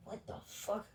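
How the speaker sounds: breathy whispered what the fuck; startled whisper. Retold or imitated. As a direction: hiss it under your breath, startled. Startled by banging on back door.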